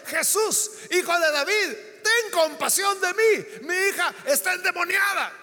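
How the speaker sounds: loud, high pleading cries; gritos de súplica. Acted. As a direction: call out after him, begging. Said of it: "Y va detrás de él gritándole". (súplica a gritos)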